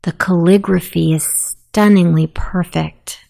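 ordinary talk

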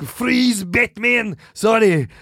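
Austrian action-star catchphrase delivery, rising into protest; mock Austrian accent. Shown as a demonstration. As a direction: demonstrate a thick, hammed-up Austrian accent. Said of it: "This is how they talk".

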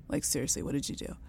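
whispered, pointed questioning; hushed interrogation. Retold or imitated. Mother whispering to her daughter so the husband won't hear. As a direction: keep it to a tense whisper.

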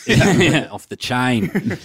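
slow, drawn-out Australian "chain", sounding like "chine"; Australian accent. Shown as a demonstration. Actor playing along with Australian expression joke, Australian accent. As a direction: stretch it in a jokey Australian accent.

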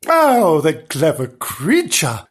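loud, drawn-out gush of delight; greatest delight. Acted. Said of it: "in the greatest delight".

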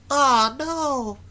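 put-on high squeaky child's voice; kid voice. Podcaster stuck in his kid voice after saying Twitter, high and squeaky. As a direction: pitch way up like a little kid. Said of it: "This is my kid voice".

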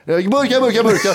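mock shouting vendor. Friend hamming up a can seller's shout. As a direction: yell burkar like a street seller. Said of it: "Han bara skriker".

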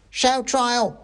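very high squeaky demand; mock mob shout. A narrator's reading. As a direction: call it out as another mouse in the mob. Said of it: "shouted another voice".

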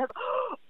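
a sudden startled intake of breath; sharp gasp. Retold or imitated. Realizing her friend is calling, sharp startled gasp. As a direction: gasp as the phone rings.

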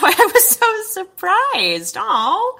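high, slow mock gushing; mock flattered. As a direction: gush like a flattered pet.

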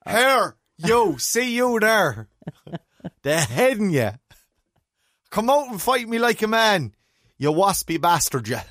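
put-on high, loud Mayo accent. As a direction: shout it in a broad Mayo accent. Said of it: "you need to do it with a Mayo accent".

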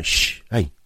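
imitating a lad shushing his mates; imitated shushing. Cinema lad to his muttering mates, shushing. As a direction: hush the group with a quick telling-off. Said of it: "lads in the group were going".

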